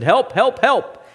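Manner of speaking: high, loud repeated calls; repeated yell for help. Retold or imitated. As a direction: call out urgently for rescue. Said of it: "finally he just yelled out".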